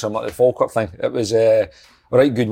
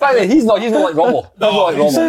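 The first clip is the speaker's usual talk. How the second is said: emphatic denial; 9 semitones higher, 4 dB louder than usual. Real, not acted. Heated denial in banter.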